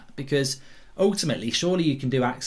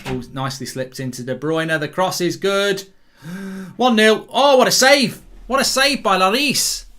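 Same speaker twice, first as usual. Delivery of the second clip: rising, louder commentator shout; excited commentary; 3 semitones higher, 4 dB louder than usual. Real, not acted. Commentator reacting to a great save, excited. Live goalmouth chance commentary.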